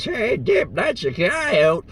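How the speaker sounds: high-pitched hillbilly drawl; Uncle Nexus. Acted. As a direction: call out slowly like a high-voiced hillbilly. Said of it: "when Uncle Nexus says".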